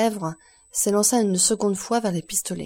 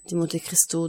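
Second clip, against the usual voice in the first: usual speaking voice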